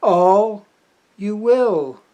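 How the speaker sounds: slow, weighty declaimed verse; poetry recital. Acted. Poet begins an untitled poem for the camera, slow declaimed poetry. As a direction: hit the opening words loudly and leave long pauses.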